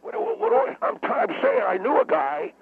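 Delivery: high-pitched flustered sputter; wound up. Acted. Guest defending himself to baffled host, wound up. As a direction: splutter back in indignation, voice jumping up. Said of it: "Will you calm down".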